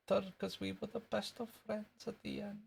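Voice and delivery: put-on high, soft Scottish boy voice; little Scottish kid, softly piping; soft and squeaky Scottish character; Jimmy Krankie. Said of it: "That was wee Jimmy Cranky".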